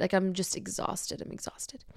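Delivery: exhausted fade into near-silence; drained. Retold or imitated. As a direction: voice falling away to a whisper. Retold outburst collapses into exhaustion.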